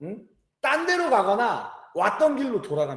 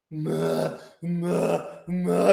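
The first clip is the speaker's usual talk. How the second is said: mock crying for mom; 5 semitones lower, much slower than usual. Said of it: "이렇게 울어요".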